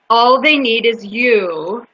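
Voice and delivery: drawn-out, deliberate reading; slow dramatic reading. Retold or imitated. Speaker reads the mural's promise to listeners, slowly and emphatically. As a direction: give every word its own slow weight.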